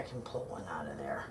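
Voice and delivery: whispered afterthought; half-voiced, secretive aside; whispering. Real, not acted. Sneaking a roll from tin.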